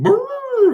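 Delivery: high rolled brrrr like a siren; mimicking sirens coming over the radio; siren imitation. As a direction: trill it up high and loud like a siren.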